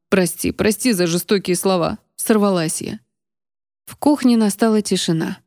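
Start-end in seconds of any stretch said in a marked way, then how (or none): none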